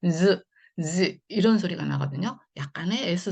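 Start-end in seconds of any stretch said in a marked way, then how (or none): 0.1-1.2 즈 then 지 with a voiced S-like buzz; buzzing z sound. Shown as a demonstration. Teacher demonstrates the ringing consonant to students. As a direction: hum 즈 into 지 with lips pressed. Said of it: "S 소리가 조금 섞여 나와요".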